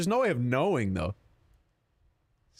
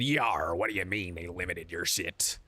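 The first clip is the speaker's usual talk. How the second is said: low growling pirate, softer; 8 semitones lower, 4 dB quieter, slower than usual. Retold or imitated. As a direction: ham it up like an old sea pirate. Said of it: "like a pirate".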